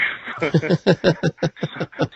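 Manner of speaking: loud, high laugh at himself; burst of laughter. Real, not acted. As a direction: laugh out at the memory. Laughs at his own story.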